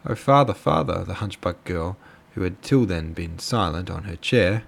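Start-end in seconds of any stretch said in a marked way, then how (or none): 0.0-1.0 higher, louder sudden appeal; raised-pitch exclamation to a parent; sudden outburst. A narrator's reading. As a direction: burst out in a pleading call. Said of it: "said suddenly".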